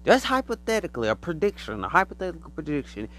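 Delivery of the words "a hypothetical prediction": ordinary talk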